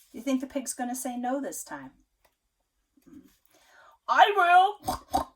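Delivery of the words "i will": loud gruff pig voice; eager pig. Acted. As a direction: grunt a keen yes. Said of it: "said the pig".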